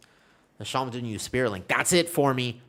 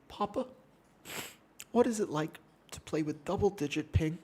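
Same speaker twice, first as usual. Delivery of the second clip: higher mock kid voice asking Papa; childlike plea; 7 semitones higher, 4 dB quieter than usual. Son asking dad about ping, high mock kid voice. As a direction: ask like a wide-eyed little boy.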